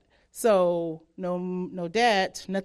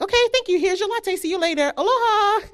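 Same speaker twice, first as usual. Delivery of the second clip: much higher, louder customer-service voice; fake-bright "Aloha" to a tourist; chirpy barista; 12 semitones higher, 5 dB louder than usual.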